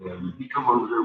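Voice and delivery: normal talking voice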